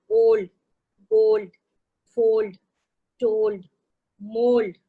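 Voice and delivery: ओल्ड, गोल्ड, फोल्ड each with a pure o vowel; teacher drilling the o vowel in a word list; o sound drill. Shown as a demonstration. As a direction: drill the rhymes with a clean o. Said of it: "ओ sound है".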